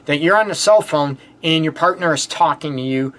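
ordinary talk, nothing marked

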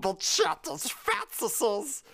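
high, drawn-out creature voice; slow, high Smeagol voice. Acted. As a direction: hiss and stretch it like a wretched creature. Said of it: "That's a pretty good Smeagol".

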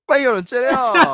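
high, loud laughing protest. Real, not acted. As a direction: blurt it out through laughter. Caught unprepared, bursting out laughing.

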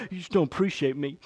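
sulky soft complaint; husband sulking at his wife; pouting. Retold or imitated. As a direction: whine it like a hurt toddler. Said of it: "like a daggum little child, and I'll pout".

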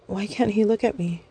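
plain reading voice

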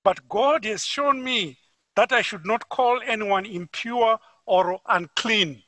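normal talking voice

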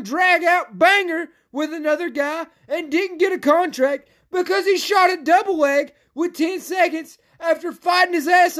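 furious high-pitched rant building to a full shout; screaming and yelling. Real, not acted. Podcaster raging at Dana over the snubbed fighter, screamed rant. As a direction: yell in outrage, far above normal pitch, peaking at the end. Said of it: "That screaming and yelling".

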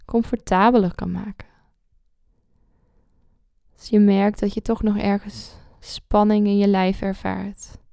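calm guide with long pauses; guided meditation. Acted.

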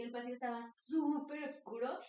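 normal talking voice

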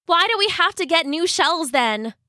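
put-on a young crab's exasperated question; very frustrated. A narrator's reading. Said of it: "cried Jasper in a very frustrated voice".

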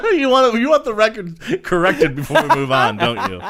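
high, loud, through laughter; laughing tease. Real, not acted. Co-host teasing about correcting the record, laughing loudly. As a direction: tease them while cracking up.

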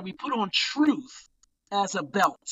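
ordinary talk, nothing marked

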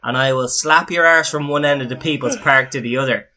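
loud half-laughing threats; mock rant. Friend threatening mate over the accent, loud mock rant. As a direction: rant it big, playful fury.

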